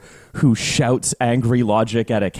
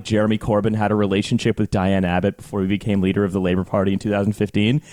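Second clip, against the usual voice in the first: normal talking voice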